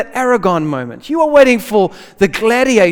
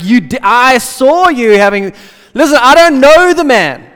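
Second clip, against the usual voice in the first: loud panicked denial; 4 semitones higher, 9 dB louder than usual. Retold or imitated. Preacher voicing Peter's third denial, loud and desperate. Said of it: "another person comes and like".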